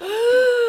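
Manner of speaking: excited gasp. Retold or imitated. Starstruck as stars come on.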